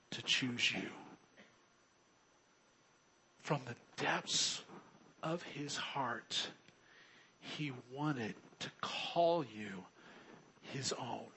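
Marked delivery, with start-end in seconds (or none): 3.4-11.3 slowing down and falling to a whisper on "his own"; softly whispered. Real, not acted. Pastor telling the church how God wants them, hushed whisper. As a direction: let it sink in, slow, hushed to a whisper. Tender climax of sermon point.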